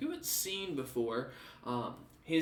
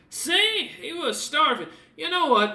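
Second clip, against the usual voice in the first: much higher, louder, gleeful; Alfred delighted; 14 semitones higher, 8 dB louder than usual. Acted. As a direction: crow about it like a pleased big man. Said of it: "and goes".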